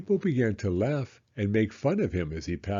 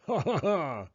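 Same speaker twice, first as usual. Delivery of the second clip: mocking laugh; 6 semitones higher than usual. Mister Dart laughs at thieves.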